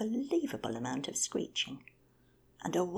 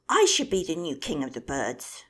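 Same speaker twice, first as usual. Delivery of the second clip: shouted bid for the crown; loud boastful claim; 14 dB louder than usual. Acted. Bird shouting to the squabbling flock, loud and boastful. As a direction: bellow it to the whole flock. Birds row over who is king.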